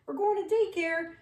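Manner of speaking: high, sing-song coax; coaxing the dog. Retold or imitated. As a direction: lure the dog into the trunk brightly. Coaxing runaway dog into car.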